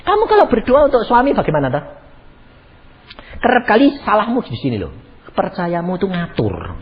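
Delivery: ordinary talk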